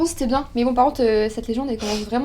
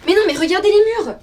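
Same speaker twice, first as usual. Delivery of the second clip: insistent alarm; 9 semitones higher, 5 dB louder than usual. Real, not acted. Insists the walls are real.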